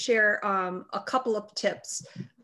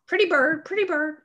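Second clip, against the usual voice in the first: parrot voice; 7 semitones higher, 4 dB louder than usual. Retold or imitated. Bird chirps pretty bird at dinner.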